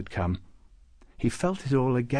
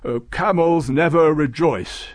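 loud, higher contradiction; interrupting objection; 6 semitones higher, 8 dB louder than usual. A narrator's reading. Babucar cutting into Farouk's rejoicing, objecting. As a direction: cut in firmly on the speaker. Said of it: "interrupted Babucar".

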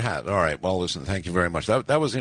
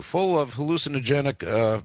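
usual speaking voice

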